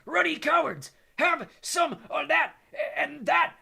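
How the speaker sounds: furious bellow, high and loud; Hagrid. A narrator's reading. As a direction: bellow it to carry to the tower. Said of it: "bellowed Hagrid".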